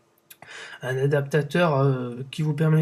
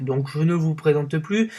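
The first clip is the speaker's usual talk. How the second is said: ordinary talk, nothing marked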